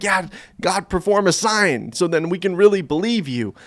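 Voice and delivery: high-pitched, insistent mock plea; demanding plea. Believer in pain asking God for a sign, demanding plea. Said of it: "when I'm in pain ... to be like".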